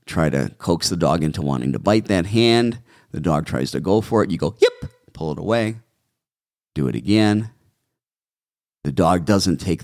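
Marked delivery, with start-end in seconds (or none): 4.6-4.9 very high, sharp yelp; high-pitched yip. Shown as a demonstration. Trainer demonstrates to owners the hurt-puppy yelp, very high. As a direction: squeal like a bitten puppy. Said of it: "That high-pitched yip".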